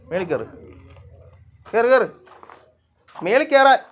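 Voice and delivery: loud, high command to the cats; real shouted command; calling out orders. Real, not acted.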